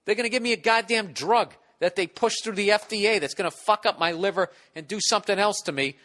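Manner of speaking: usual speaking voice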